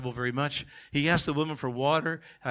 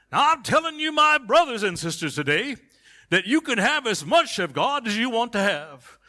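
much higher, loud hammed-up revival preaching; old-time Pentecostal preacher; 9 semitones higher, 6 dB louder than usual. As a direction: imitate a fiery old-style preacher. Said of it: "This is how I used to hear it".

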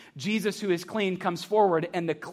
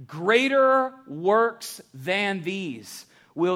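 "Greater works" drawn out very slowly, rising; sermon title; much slower than usual. Shown as a demonstration. Pastor unveiling the sermon title to the church, slow and weighty. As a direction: announce the title slow and deliberate. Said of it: "here comes the sermon title".

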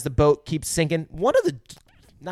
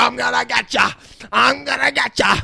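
higher, louder hammed-up monster threat; shark voice; 9 semitones higher, 4 dB louder than usual. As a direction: play the shark taunting its prey. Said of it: "He's like".

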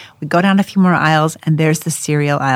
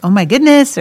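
higher, louder exclamation; mock amazement; 8 semitones higher, 5 dB louder than usual. Mother guessing the translator's answer, in mock amazement. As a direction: give a sample cry of wonder. Offers possible meanings of excited cry.

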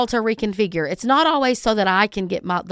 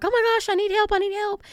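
high, frantic pleading cry for help; mock panicked scream for help; panicked caller; 11 semitones higher, faster than usual. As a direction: play a hysterical 911 caller, pitched way up. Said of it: "screaming and hollering".

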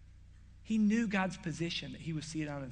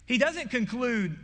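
emphatic preaching; 6 semitones higher, 6 dB louder, slower than usual. Real, not acted. Preacher stressing a contrast.

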